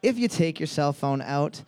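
normal talking voice

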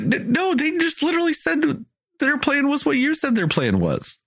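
high-pitched, exasperated correction; incredulous objection. Retold or imitated. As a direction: stammer into a high, baffled protest. Acting out own objection to film.

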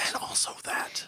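hushed whispered aside; whispering. Real, not acted. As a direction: whisper it under your breath. Quiet aside in banter.

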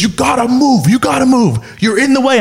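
high-pitched, pushy retold order; aggressive bouncer. Bouncer ordering a customer away from the bar, aggressive. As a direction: imitate an irate bouncer ordering someone aside.

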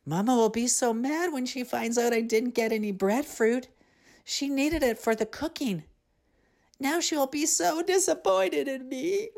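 put-on high, whimpering girl's voice; a scared girl near tears; tearful girl. A narrator's reading. Serena alone at dusk, high and tearful. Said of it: "Serena cried".

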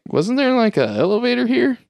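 a squeaky, baffled question; puzzled disbelief. Retold or imitated. Crew finding the elevator vanished, high and puzzled.

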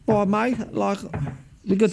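imitating an Australian mate's accent; bad Australian accent. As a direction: have a go at an Aussie mate, not very convincingly. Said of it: "I can't do an Australian accent".

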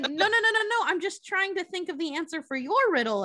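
mock panicked Alice; flustered denial. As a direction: quickly take it back, high and flustered. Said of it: "she's like".

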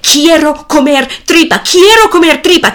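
put-on spoiled boy's demand, climbing; rising demand. A narrator's reading. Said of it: "el hijo grita".

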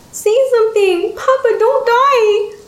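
put-on very high childlike wail; high-pitched little-girl despair; distraught girl begging. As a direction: plead desperately, dragging out the words.

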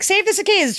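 put-on high-pitched child's plea; high little boy voice; pleading kid. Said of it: "you've got your boy sitting there like".

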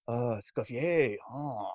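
low, hushed French reverence, put on; imitated French person. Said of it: "say it to a French person, they'll be like".

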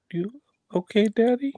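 put-on high, small child asking; a toddler checking on her dad; little daughter voice. Daughter comforting her dad in a small voice. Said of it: "be like".

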